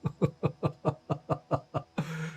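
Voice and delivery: long, put-on menacing chuckle; mock sinister laugh, drawn out; Trump's creepy laugh. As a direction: imitate a villainous staccato laugh. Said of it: "너무 섬뜩하게 트럼프가 웃으면서 이야기를".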